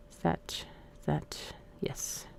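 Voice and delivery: soft whisper, almost unvoiced; quiet self-check in a whisper; whispered to herself. Real, not acted. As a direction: murmur it while checking something. Checking something under her breath.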